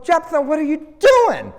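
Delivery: high-pitched, appalled questioning; exasperated disbelief. Acted. Preacher reacts to Jephthah killing his people, exasperated disbelief. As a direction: challenge him in mock dismay.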